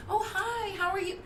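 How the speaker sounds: a put-on high friendly sales voice; customer service voice. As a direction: chirp the greeting like you're greeting a customer. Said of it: "Did you hear me just do it?".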